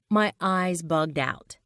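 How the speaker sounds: plain reading voice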